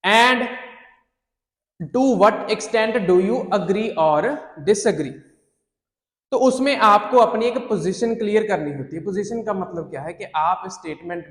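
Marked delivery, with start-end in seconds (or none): 0.1-5.1 slow dictation. Shown as a demonstration. Teacher listing essay question types, very slow. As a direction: question forms drawn out very slowly. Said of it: "अक्सर आप".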